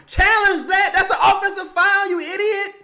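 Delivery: high outraged scream; wrestling commentator. Real, not acted. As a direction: yell at the coach to challenge. Screaming for a challenge.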